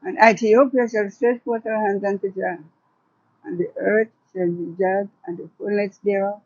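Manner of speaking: measured, rhythmic poetry recital with long pauses; poem reading. Acted. Poet reads her poem to the interviewer, measured and solemn. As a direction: read it like a hopeful vision of Africa. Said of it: "So can you share one of your poems here?".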